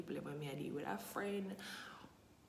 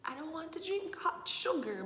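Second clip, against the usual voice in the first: exasperated; 10 semitones higher, 4 dB louder than usual. Real, not acted. Complaining about sweet tea.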